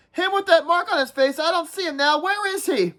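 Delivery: whiny schoolboy nagging the group, pitched well up; Piggy's voice. Acted. Piggy voiced in read-aloud.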